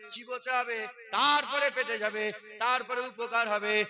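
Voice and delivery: loud, high, chanting sermon climax; roaring waz delivery; full-force preaching. Real, not acted. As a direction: preach it to the whole crowd at full power.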